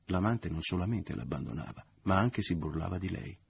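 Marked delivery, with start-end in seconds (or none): none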